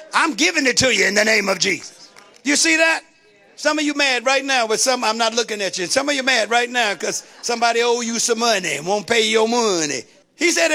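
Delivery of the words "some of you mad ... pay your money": ordinary talk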